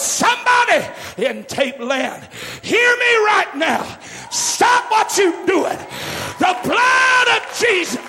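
high, loud, slow preaching shout; the preaching on the tape. Retold or imitated. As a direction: call through the tape to a man about to give up. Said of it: "the first words I heard over that tape".

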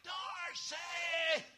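high, strained holler; loud falsetto cry. Acted. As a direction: holler for help from the ground. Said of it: "I'm hollering".

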